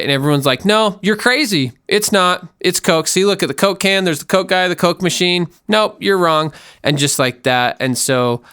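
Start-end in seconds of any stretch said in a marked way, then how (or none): 0.6-6.5 high, emphatic mock denial; dismissive insistence. Everyone gaslighting someone over Coke, dismissively insistent. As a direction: brush the doubter off in a pitched-up voice. Acting everyone denying the obvious.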